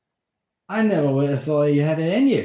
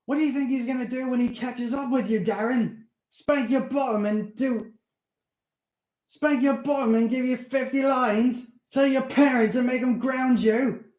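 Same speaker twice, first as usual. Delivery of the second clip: much higher, louder panicked rant; angry; 7 dB louder than usual. Acted. Steve warning Darren the vampire will come, angry. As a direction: frighten him with the danger.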